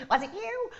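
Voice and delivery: high cackling accusing witch. Pretend. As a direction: accuse them like a wicked witch. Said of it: "I sound like the witch".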